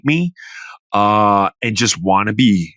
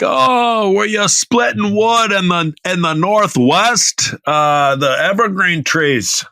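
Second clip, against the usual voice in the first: high, hammed-up attempt at a Nigerian accent; 8 semitones higher, slower than usual. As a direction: play a lightly faked Nigerian accent, pitched up. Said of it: "I don't know what accent that was".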